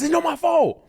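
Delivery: high-pitched indignant protest. Retold or imitated. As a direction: act out a fed-up teenager answering back. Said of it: "I raised my voice".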